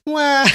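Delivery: high loud mock wail; imitated wail. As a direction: wail it like a silly crying girl. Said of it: "Who cries like that?".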